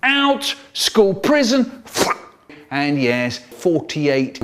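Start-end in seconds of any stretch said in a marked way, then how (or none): 0.0-1.8 banishing order. Acted. Teacher's sentence acted.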